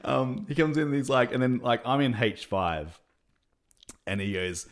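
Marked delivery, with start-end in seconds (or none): none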